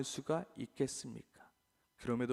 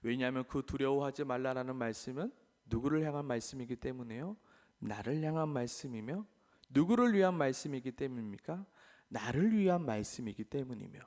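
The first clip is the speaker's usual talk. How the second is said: ordinary talk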